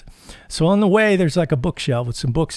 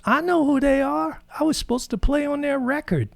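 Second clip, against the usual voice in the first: much higher put-on Mac voice; imitating Mac; 13 semitones higher than usual. Mac telling the narrator he knows [name], mock voice. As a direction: sound a little offended, like Mac. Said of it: "He goes".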